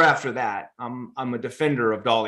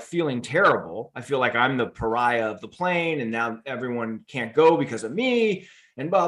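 usual speaking voice

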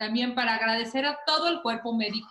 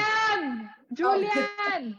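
high called names across the room; calling out; 9 semitones higher, much slower than usual. Real, not acted. Host calling the boys to come over, high calling voice.

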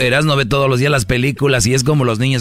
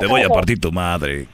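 slightly lowered film-quote voice; film character impression; 5 semitones lower than usual. Quoting the tuntún staring from the sidewalk, lightly voiced character impression. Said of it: "se le queda viendo y dice".

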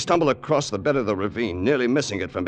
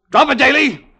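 shouted threat at gunpoint; tense gunpoint commands; 9 dB louder than usual. Acted. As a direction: bark the order, ready to fire. Gunpoint standoff.

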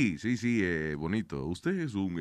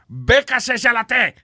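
mock Hungarian; 9 semitones higher, 5 dB louder, slower than usual. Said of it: "en Hungría, si a ti te dicen".

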